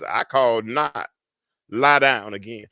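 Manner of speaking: low, unhurried dismissal; a hint of a drowsy old master; lightly voiced Eli. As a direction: send them back to bed calmly. Acts Eli's reply to Samuel.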